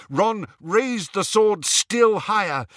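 plain reading voice

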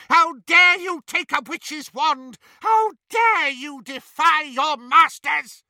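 high, loud outraged shout, slowing; furious shout. Acted. Bellatrix raging at elf.

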